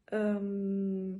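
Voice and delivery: ordinary talk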